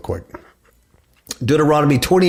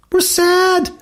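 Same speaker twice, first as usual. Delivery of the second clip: very high whimpering mock sadness; mock sad. Preacher voices the mourning doves, high and whiny. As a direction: whine it like a sad little dove.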